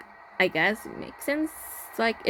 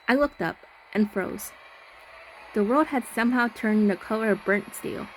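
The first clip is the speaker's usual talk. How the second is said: even, slightly hushed horror narration; story narration; 3 dB quieter than usual. A narrator's reading. Player reading the horror game's narration, slightly hushed. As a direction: read it like a creeping ghost story.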